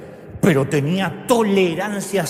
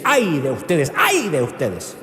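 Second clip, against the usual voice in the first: Jesus rebuking; slower than usual. Retold or imitated. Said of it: "le dice".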